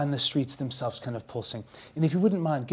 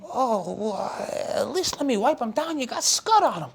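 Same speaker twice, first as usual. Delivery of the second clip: put-on kid voice; 10 semitones higher, 4 dB louder than usual. Shoeshine boy insists.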